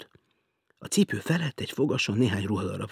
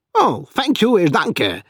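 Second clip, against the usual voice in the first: higher, loud mock-polite thanks; cheerful thanks; 10 semitones higher, 9 dB louder, slower than usual. Félix thanking the lift boy, loud and breezy. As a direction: toss off the thanks lightly. Guest replies playfully.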